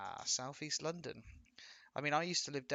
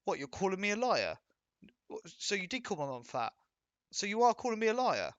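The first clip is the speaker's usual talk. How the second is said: mock outrage; 7 semitones higher, 6 dB louder than usual. Mock offended roadman quarrel.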